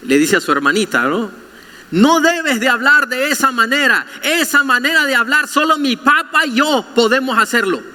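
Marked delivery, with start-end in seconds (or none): 1.9-7.8 high, lightly voiced little boy; a hint of a smug little brother; child scolding. Little boy correcting his sister, mock prim.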